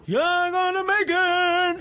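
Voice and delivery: high, slow, loud Oprah shout. Retold or imitated. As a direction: belt it like a giveaway host. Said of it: "So she did one of her giveaways".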